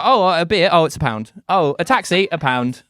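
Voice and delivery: mock delighted tourist. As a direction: gleefully marvel at how cheap everything is. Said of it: "you go".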